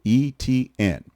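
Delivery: E T N said as three spaced letter sounds, ee-tee-en; simplified spelling pronunciation. Retold or imitated. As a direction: spell out E T N slowly, as it looks. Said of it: "it's exactly how it looks".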